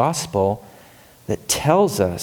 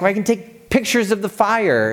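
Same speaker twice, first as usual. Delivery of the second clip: higher, irritated retort from the photographer; 9 semitones higher than usual. Retold or imitated. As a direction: snap back, mildly annoyed. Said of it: "And irritated".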